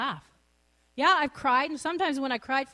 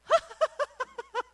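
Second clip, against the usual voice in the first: bursting into a high laugh; real laugh; 12 semitones higher than usual. Real, not acted. Laughing with joy about the Lord. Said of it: "I laugh my head off".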